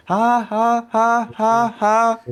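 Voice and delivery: high, hammed-up "ha ha" laugh; terrible bouncy laugh. Imitating the orc's laugh at the table, comically terrible. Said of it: "bouncy, terrible laugh".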